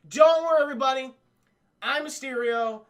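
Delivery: loud, high hammed-up showman; grand villain. Mysterio reassuring the crowd, loud hammed-up showman. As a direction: boom it out like a stage villain.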